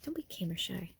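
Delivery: soft unvoiced whisper to the moth. Real, not acted. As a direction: hush it, coaxing a shy pet. Coaxing the moth for a photo.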